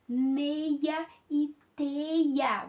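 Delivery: "meia" and "teia" drawn out very slowly; very slow demo of "meia e teia"; sound out rhymes. Shown as a demonstration. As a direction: stretch "meia" and "teia" so the matching endings ring out. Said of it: "vamos perceber os sons".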